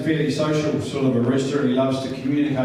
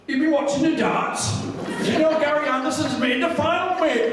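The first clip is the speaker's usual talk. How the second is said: higher, Welsh-tinged impression of Davey; chirpy mimicry of a mate calling; imitating Davey; 13 semitones higher than usual. Voicing Davey on the phone.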